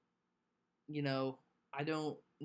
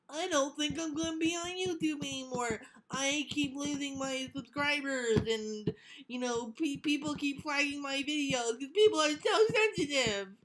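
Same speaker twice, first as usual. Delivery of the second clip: high, whining mock YouTuber; whiny complainer voice; 11 semitones higher, 3 dB louder than usual. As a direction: whine it like a sulky quitter. Mocking YouTubers quitting.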